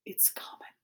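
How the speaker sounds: barely audible, ominous whisper; hushed warning. A narrator's reading. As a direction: breathe a warning of approaching danger. Maddie senses danger coming.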